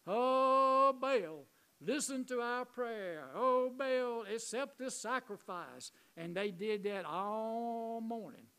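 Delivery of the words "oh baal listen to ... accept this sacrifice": loud, drawn-out pleading chant, pitched high; prophets' prayer. Retold or imitated. Prophets praying to Baal at the altar, pleading loudly. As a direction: call out to a god, holding the first word long. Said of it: "they started praying to their God".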